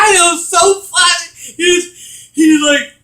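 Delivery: high, loud, laughing. Real, not acted. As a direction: say it while laughing. Laughing at story.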